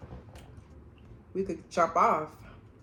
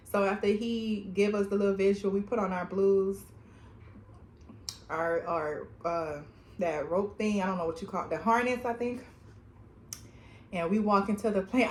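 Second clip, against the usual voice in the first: normal talking voice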